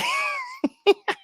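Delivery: very high, wheezy burst of laughter. Real, not acted. Cracking up at TMK, high laugh. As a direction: squeal with laughter.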